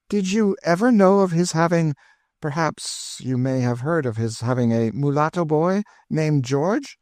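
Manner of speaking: a hint of an eager French lady. A narrator's reading. Madame de Thou questions George.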